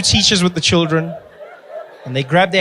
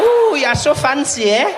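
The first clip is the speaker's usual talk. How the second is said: impressed woman; 8 semitones higher, 5 dB louder than usual. Retold or imitated. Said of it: "She's like".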